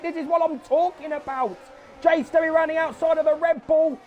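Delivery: lively live play-by-play; excited sim racing commentator; race commentary. Acted. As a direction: call the race as it happens.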